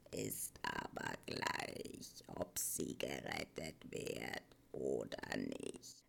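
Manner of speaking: barely voiced, very low, slow murmur; wheezing old Morla. A narrator's reading. Morla tells Atreyu saving her makes no difference, wheezy indifference. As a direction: breathe it out low, past caring. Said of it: "schnaufte die Moala".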